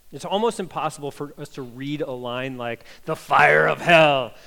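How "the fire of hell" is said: dramatic doom voice. Acted. Ominous quoted phrase.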